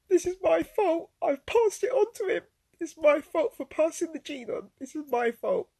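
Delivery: tearful, high, loud anguish; real distress. Real, not acted. Mother recalls finding her lifeless son.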